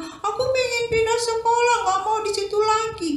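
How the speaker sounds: put-on high, pleading little boy; whiny child. Boy telling his mother he wants to change schools, high. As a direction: whine to leave the school. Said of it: "Dia bilang".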